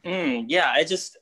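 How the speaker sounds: ordinary talk, nothing marked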